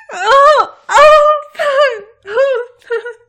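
high, loud ecstatic cries; climax cries. Acted. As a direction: let the climax break out in cries. Climax in the sex scene.

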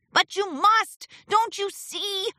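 high, insistent plea; frustrated pleading. A narrator's reading. Martingale urging Discord to leave, frustrated. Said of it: "stomped his hooves in frustration".

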